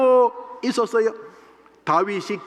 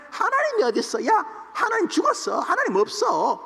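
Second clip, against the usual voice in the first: high sneering jeer at God; mocking taunt; 7 semitones higher than usual. Goliath taunting Israel, high mocking sneer. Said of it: "이러면서 하나님을 조롱하고".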